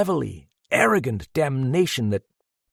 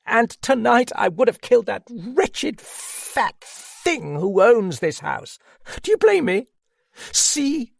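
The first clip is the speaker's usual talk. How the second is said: much higher, slow, fierce outburst; passionate outcry; 12 semitones higher, 4 dB louder than usual. A narrator's reading. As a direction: fling each word out in passionate defiance. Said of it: "she cried passionately".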